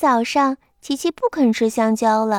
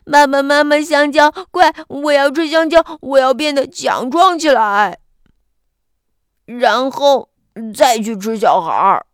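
louder hungry shout home; 5 dB louder than usual. Acted. Hungry Kiki runs home calling parents, loud. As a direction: call out loudly to the parents. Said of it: "一边喊".

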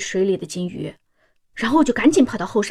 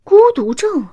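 shocked disbelief; 8 semitones higher, 6 dB louder than usual. Acted. Mother stunned by doctor's diagnosis.

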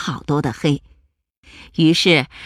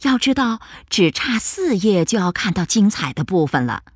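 ordinary delivery, nothing marked

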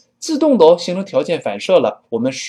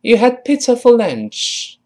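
slow, higher-pitched teacher's model sentence; model reading; 10 semitones higher, 3 dB louder than usual. Shown as a demonstration. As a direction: read it out slowly for learners to repeat. (慢速、提高音调的领读示范)

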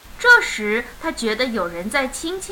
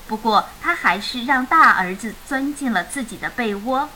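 ordinary delivery

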